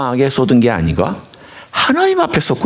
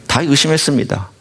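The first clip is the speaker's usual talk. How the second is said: usual speaking voice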